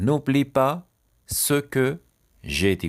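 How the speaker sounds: "N'oublie pas", "ce que", "j'ai dit" split by pauses; chunk by chunk. Shown as a demonstration. Teacher dictating a sentence to learners, chunk by chunk.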